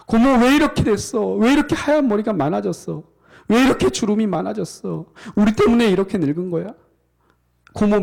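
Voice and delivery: high, loud tearful crying out; weeping. Acted. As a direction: sob the words out, up high and loud. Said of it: "엉엉 울기 시작합니다".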